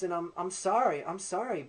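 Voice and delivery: ordinary talk, nothing marked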